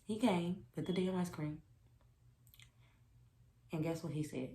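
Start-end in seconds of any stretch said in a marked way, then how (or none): none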